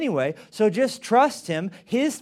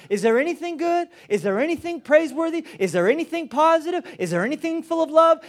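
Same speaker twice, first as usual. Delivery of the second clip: rising questions; 8 semitones higher than usual. Acted. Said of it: "Philippians says".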